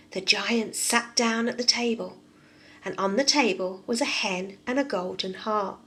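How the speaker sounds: put-on lively read-aloud for small children; storybook reading. A narrator's reading. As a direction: tell it like a bedtime story for kids. Reads fairy tale to children.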